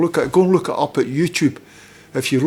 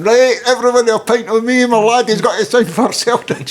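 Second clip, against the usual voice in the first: high, loud shout to the whole pub; excited mock yell, pitched right up; imitated proud announcement; 11 semitones higher, 6 dB louder than usual. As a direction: bellow it like a boasting dad at the bar. Dad announces news to whole pub.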